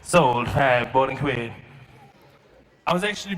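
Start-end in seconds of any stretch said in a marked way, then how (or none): 0.0-1.5 low, loud auctioneer's call. Acted. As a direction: call it like an auctioneer selling lots. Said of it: "It's like an auction".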